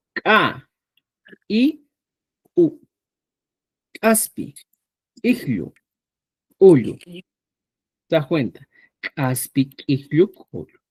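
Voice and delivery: Caspi, Iglio, Ullo with a hard glottalized k; a popped ejective k. Shown as a demonstration. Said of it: "Imagínate".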